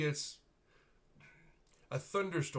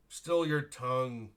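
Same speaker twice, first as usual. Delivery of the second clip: stern rebuke; 2 semitones higher, 7 dB louder, slower than usual. Acted. Captain rebukes the noble.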